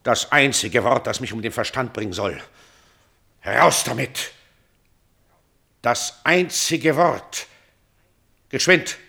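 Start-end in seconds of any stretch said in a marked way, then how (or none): 3.4-9.0 laut, hoch und fordernd; drängend laut. Acted. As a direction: verlang es sofort. Vater fordert das Wort heraus. (laut und drängend fordernd)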